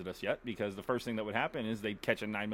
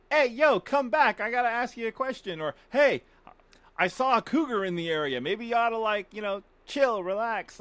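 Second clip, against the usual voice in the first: loud, high call to a wild animal; hailing a deer from afar, loud and high; 11 semitones higher, 7 dB louder than usual. Pretend. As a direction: shout across the woods at a deer. Voicing himself talking to animals.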